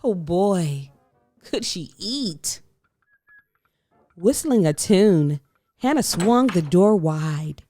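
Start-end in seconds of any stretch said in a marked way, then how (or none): none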